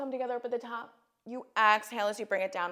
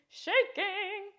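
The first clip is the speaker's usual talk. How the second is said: much higher, straining with effort; squeaky strained repeat; 12 semitones higher than usual. Real, not acted. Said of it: "My legs are shaking".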